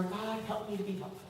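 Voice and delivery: soft, quick murmured plea; quiet prayer. Retold or imitated. Woman praying at the grocery store, quiet prayer. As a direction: drop into a private little prayer.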